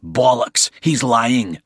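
loud, scornful cut-in; scornful interruption. A narrator's reading. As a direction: call his bluff sharply. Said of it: "Bald cut in".

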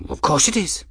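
put-on high, eager old man voice; eccentric old man. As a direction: declare it like a cheerful prophet.

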